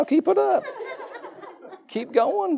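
playful cheer. Real, not acted. Cheering congregation to have babies.

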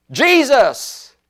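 high cry into a cave; calling out. Shown as a demonstration. Preacher showing how a voice carries in a cave, calling out. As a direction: call the name out high so it echoes. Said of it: "you s-, you say".

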